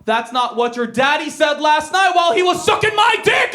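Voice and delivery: hammed-up retort climbing into a yell; sassy shouted comeback. Boy fires back at the school bully, building to a shout. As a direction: build from talk to a high, loud shout.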